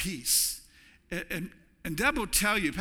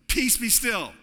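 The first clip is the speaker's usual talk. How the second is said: raised, ringing command; 5 semitones higher, 5 dB louder, slower than usual. Acted. Preacher voicing Jesus commanding the storm, raised and firm. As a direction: speak it like Jesus over the storm.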